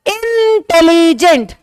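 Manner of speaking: Intelligent drawn out piece by piece, first syllable held long; broken into syllables. Shown as a demonstration. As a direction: chop intelligent into slow chunks, "इन... tele... gent". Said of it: "तीन टुकड़ों में मुंह से बाहर आ रहा". (Intelligent को टुकड़ों में खींचकर बोलें)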